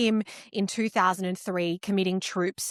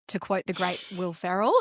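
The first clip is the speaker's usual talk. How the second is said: usual speaking voice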